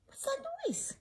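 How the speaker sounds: a high, soft, puzzled librarian. Acted. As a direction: librarian wondering at the noise. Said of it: "She said".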